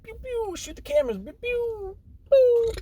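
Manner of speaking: very high pew pew sounds; blaster noises. Acted. Heroes firing at the cameras, high pew pew noises. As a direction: make toy blaster shots.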